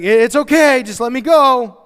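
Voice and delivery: very loud, high mock insistence; acted-out impatient wife; insistent wife. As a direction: brush him off forcefully. Acts wife brushing off husband's objection.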